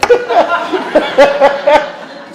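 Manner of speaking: real laugh at himself. Real, not acted. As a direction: burst of high laughter. Laughing at own math slip.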